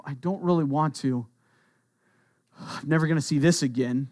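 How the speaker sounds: mock reluctance, a little low and subdued; reluctant. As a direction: part with it unwillingly. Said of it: "it's not reluctantly of".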